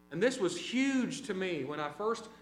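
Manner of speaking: ordinary talk, nothing marked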